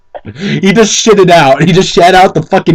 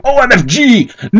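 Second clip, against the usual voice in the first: hammed-up scream; 8 semitones higher than usual. Dramatic reading of fan fiction.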